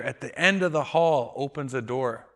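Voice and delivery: ordinary talk, nothing marked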